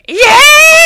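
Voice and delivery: a big crowd-style yell of triumph; cheer. Retold or imitated. The expected team cheer after the one-liner, loud. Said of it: "expecting to hear".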